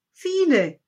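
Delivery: high, loud burst of eagerness; eager cry. A narrator's reading. Child eagerly answers the wise man's question. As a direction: blurt it out gladly. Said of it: "rief das Kind".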